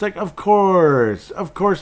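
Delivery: high, loud, slow exasperation; of course that happened; mock furious disbelief; exasperated. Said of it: "I was furious".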